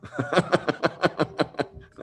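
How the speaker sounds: high, rapid-fire giggle; helpless high-pitched laughter; giggling laugh. Real, not acted. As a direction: let out a long, high run of giggles. Amused by a viewer's comment.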